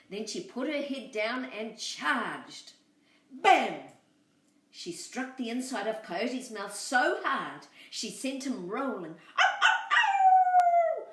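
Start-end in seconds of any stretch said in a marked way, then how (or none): 3.3-3.8 loud punchy sound effect; bam. A narrator's reading. Lamb hitting the coyote's mouth, loud punchy bam. 9.4-11.1 loud high yelping howl of pain. Acted. Coyote hurt in the mouth, loud high howl. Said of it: "howled the coyote".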